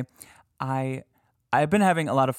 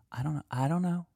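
ordinary talk, nothing marked

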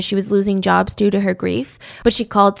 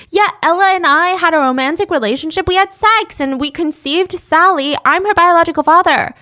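higher, louder indignant put-on voice; mock Frank; 6 semitones higher, 4 dB louder than usual. Frank in jail insisting to police, mock indignant. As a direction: scoff it like a smug liar. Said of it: "he said".

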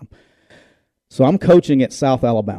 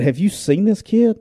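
higher, slower retold incredulity; slow, pitched-up exasperated question to a coach; incredulous; 7 semitones higher than usual. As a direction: ask it like you can't believe him. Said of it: "I said".

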